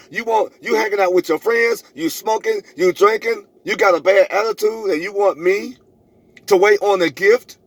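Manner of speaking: ordinary talk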